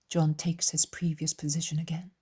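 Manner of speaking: usual speaking voice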